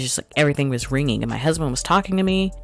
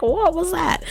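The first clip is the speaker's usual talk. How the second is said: startled. Retold or imitated. Waking from smelling salts.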